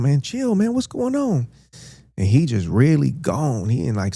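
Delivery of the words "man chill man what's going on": high, pleading calm-down; calming him down. Retold or imitated. Telling Juan Lo to chill, high pleading. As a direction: try to talk him out of it. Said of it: "I'm telling him".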